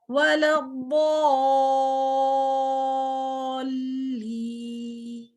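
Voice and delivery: वलद्दॉल्लीन with the long vowel held six counts, very slow; six-count madd. Shown as a demonstration. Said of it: "six counts देने".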